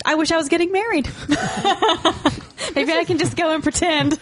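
high, giggly and wistful; laughing. Real, not acted. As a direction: laugh through the wish. Wistful joke about getting married.

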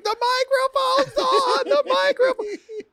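high, slow, stretched call of a panicked teacher; teacher wailing that the mic is still on; frantic teacher call. Retold or imitated. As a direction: call it out while running down the hall. Teacher running down hallway calling.

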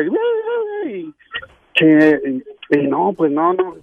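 ordinary delivery

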